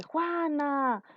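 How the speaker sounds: high, falling call without shouting; calling someone. Shown as a demonstration. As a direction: sing out the name as a demo. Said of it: "Cuando llaman a alguien". (llamando por su nombre, agudo)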